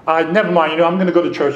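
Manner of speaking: loud, high-pitched nervous backpedal; chickening out at the office door; nervous backing out. Retold or imitated. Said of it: "I was like".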